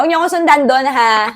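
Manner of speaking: half-joking warning to friends; playful warning. Pretend. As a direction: tease them, don't follow me. Teasing viewers not to follow.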